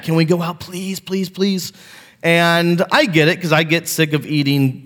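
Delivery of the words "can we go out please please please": lightly voiced kids pleading to eat out, soft on the pleases; kids begging. A pastor voicing his kids begging him to eat out, mild mock plea. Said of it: "they beg".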